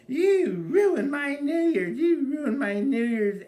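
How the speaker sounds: high, slow, loud laughing wail; mock wailing wife. Comedian imitating his wife wailing at him, high and loud. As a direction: wail it like a melodramatic drunk wife.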